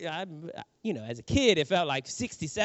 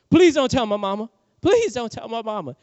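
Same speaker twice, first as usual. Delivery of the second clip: louder, higher begging; pleading; 5 semitones higher, 6 dB louder than usual. Retold or imitated. Hurt boy begging his cousin not to tell mama, pleading. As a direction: beg like a scared little boy.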